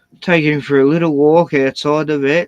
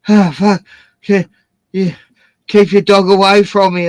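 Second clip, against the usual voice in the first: raised, rising voice, alarmed; retold alarm; 6 semitones higher, 7 dB louder than usual. Man warning dog owners, alarmed and louder. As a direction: shout it at the people to keep their dog away. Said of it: "and I'm going".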